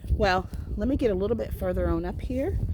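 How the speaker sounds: usual speaking voice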